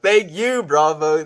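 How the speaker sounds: high, loud burst of gratitude; emphatic thanks. Real, not acted. Streamer thanks a viewer for talking real, loud and heartfelt. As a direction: exclaim your thanks, pitch leaping up.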